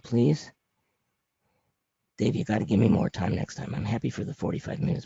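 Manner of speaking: ordinary talk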